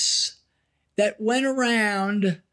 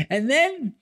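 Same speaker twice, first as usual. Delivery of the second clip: normal talking voice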